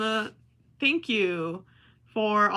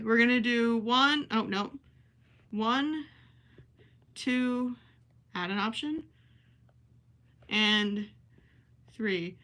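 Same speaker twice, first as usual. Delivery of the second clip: usual speaking voice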